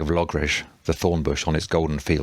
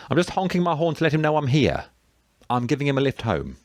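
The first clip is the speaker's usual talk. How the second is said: high, sustained call in reply; calling back; 9 semitones higher than usual. A narrator's reading. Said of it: "Thomas called back".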